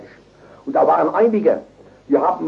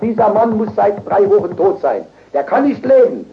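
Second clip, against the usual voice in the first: acted high, insistent pronouncement; emphatic doctor's verdict; 7 semitones higher, 4 dB louder than usual. Doctor declares the patient must be dead, emphatic and insistent. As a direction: imitate an incredulous doctor, pitched up.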